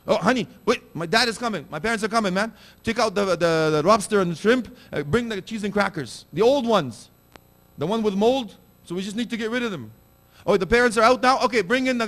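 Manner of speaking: high, loud, hammed-up frantic son; mocking ungrateful son. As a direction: ham up a panicky son hiding the good food. Mocking a stingy son hiding food.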